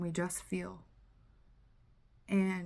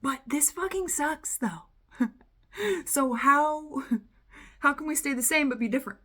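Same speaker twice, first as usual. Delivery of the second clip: higher, loud protest breaking into laughs; exasperated mock complaint; complaining conscious mind; 6 semitones higher, 6 dB louder than usual. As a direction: whine in frustration, half laughing. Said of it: "your conscious mind, all the while, is going".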